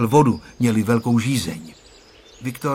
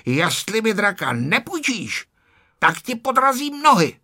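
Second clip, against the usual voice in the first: angry yell; 9 semitones higher than usual. A narrator's reading. Ferda angrily threatening to trip Honzík. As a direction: threaten him in a temper. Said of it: "Ferda se začal zlobit a křičel".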